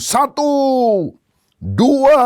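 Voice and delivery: high, loud countdown shout; general's menacing count. Acted. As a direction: call out each number like an execution count. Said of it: "lalu dia mulai hitung". (hitungan lantang sang jenderal)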